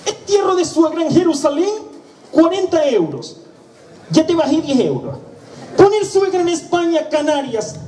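muy agudo y algo lento, exasperado; funerario harto. Acted. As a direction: suelta la oferta con impaciencia, muy alto de tono. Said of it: "el buen humor del tío de la funeraria se acabó". (funerario que pierde la paciencia, voz muy aguda y lenta)

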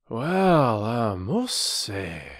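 slow, drawn-out amazement; wondering working man; astonished porter. A narrator's reading. Perks amazed by the story.

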